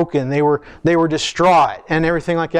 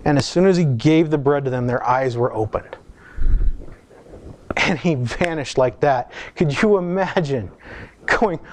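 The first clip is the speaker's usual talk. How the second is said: normal talking voice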